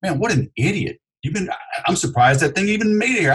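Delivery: mock insulting salesman. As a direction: tease the customer's car with scorn. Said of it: "If I go".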